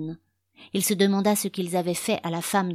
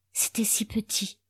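breathy, half-whispered thought; softly half-whispered musing; hushed inner thought; 3 semitones higher, 4 dB quieter than usual. A narrator's reading. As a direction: think it aloud, soft and airy. Narrator voices Winston's inner thought.

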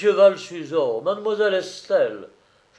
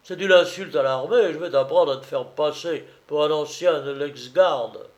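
outraged drum major, loud at the outset; angry accusation; 4 semitones higher, 6 dB louder than usual. Acted. Major threatening the false old comrade, angry and forceful. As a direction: scold the impostor with raised voice. Major furious at being fooled.

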